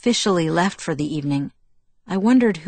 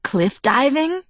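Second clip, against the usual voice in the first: high, drawn-out teasing question; playful suggestion; 8 semitones higher, slower than usual. A narrator's reading. Jacob suggests an outing to Bella, playful. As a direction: float the idea slowly, pitched up. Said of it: "laughing again".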